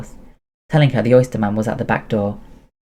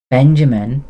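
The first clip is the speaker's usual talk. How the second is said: put-on French-accented call of Benjamin, louder, name lifting at the end; 3 semitones higher, 6 dB louder, slower than usual. Anna calling Benjamin by name, in a French accent. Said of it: "She never lost her French accent".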